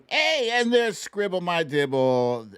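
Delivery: ordinary talk, nothing marked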